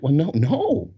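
high-pitched, hammed-up refusal; mock-indignant protest, pitch jumping up; aggravated protest. Husband refusing his wife's break from dancing, comically aggravated. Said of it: "so I'm getting aggravated".

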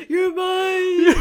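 high loud yell, laughing; mock yelling. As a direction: howl it like a lovesick fool. Joining the mock screaming.

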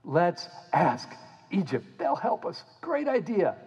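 high, fretful cartoon voice; hammed-up frantic people; frantic crowd. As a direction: babble in fake panic, then settle on Egypt. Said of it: "and they're like".

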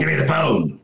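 imitating a hoodie villain's distorted growl; lightly put-on menacing masked-villain voice. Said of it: "like, a distorted voice".

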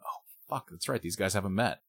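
very soft, as if to oneself; hushed realisation. Acted. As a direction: let it dawn on you under your breath. Acts out viewer's dawning realisation.

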